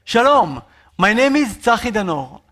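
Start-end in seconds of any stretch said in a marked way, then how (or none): none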